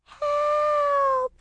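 very high, tiny cry for help; thin little high-pitched wail; tiny distant voice. A narrator's reading. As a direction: squeak it out like someone small and trapped. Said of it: "comes her tiny reply".

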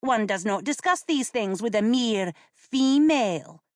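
put-on high, lofty young boy. A narrator's reading. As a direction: look down your nose at her. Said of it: "said Adam loftily".

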